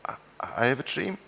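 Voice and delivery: soft, tentative reply with a rising end; quiet, hesitant and gentle, voice going up; gentle answer. Retold or imitated. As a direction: answer very gently, lifting into a timid question. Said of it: "I answered very gently".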